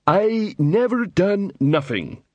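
slow, high-pitched troll drawl; sullen troll protest. A narrator's reading. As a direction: drag each word out like a dim troll. Troll suspect protests his innocence.

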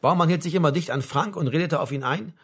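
usual speaking voice

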